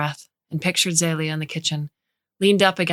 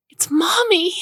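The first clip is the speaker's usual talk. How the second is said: put-on very high, louder gleeful child's shriek; even more excited child; 14 semitones higher, 4 dB louder than usual. A narrator's reading. Older sister repeating the news to her sister, squealing high. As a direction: squeal it, joy spilling over.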